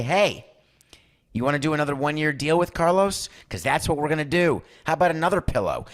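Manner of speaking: mock the owners offering yet another short deal; owners' mock offer. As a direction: team owners needling the agent, a touch loud and smug. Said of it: "they're gonna look at Scotty and say".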